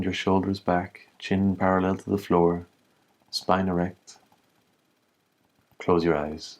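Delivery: calm, even meditation voice with long pauses; slightly softened, level-toned meditation guide; guided meditation. Acted. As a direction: guide them gently inward, leaving space between phrases. Leads a humming breath meditation.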